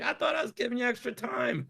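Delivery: very high-pitched retold reply; high mock protest. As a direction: squeak it out as the guard's defence. Retold guard's surprised reply.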